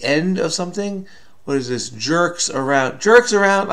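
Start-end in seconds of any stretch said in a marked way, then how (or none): 3.0-3.7 higher, louder burst of getting it; sudden realization. Real, not acted. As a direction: exclaim it as the answer clicks. Suddenly gets the clue.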